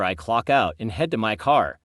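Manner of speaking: ordinary delivery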